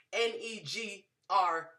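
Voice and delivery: letters N E G R spaced slowly; spelled out. Shown as a demonstration. Explaining husband's language, slow spelled-out letters. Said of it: "He's speaking".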